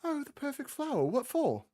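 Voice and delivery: intrigued gnomish woman. Acted. Gnome woman to Dio about his flower quest, high and intrigued. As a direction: lift way up, charmed and nosy.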